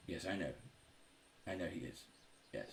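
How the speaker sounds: low, hushed, placating aside; overheard through a door. Acted. Doctor to orderlies behind the door, in a low aside. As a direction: softly hush a protesting underling. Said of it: "hear him through the door".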